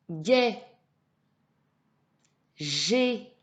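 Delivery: each syllable held out, carefully articulated; syllable drill. Shown as a demonstration. Teacher reads syllables for a child to repeat, deliberate drill voice. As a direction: read out isolated syllables for repeating. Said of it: "je lis, tu répètes".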